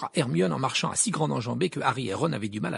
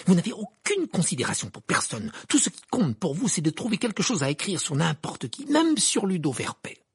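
higher, indignant reproach; through gritted teeth; 6 semitones higher, 3 dB louder than usual. A narrator's reading. Hermione accuses Rita of caring for nobody, through gritted teeth. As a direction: accuse with contained rage. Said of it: "dit-elle entre ses dents serrées".

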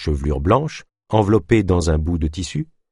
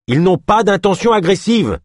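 loud, high urgent plea; urgent call; 12 semitones higher, 5 dB louder than usual. A narrator's reading. Ganesh shouting at the soldiers not to fire, loud and high. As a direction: shout to stop them firing.

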